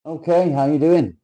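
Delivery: high, loud hello across the room; hearty greeting. Acted. Greeting his mate Lenny, loud and high. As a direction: greet him big and cheery.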